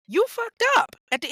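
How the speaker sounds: sudden loud high yell of blame; angry outburst. Real, not acted. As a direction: burst out at her, pitch shooting up. Ranting at convicted mother.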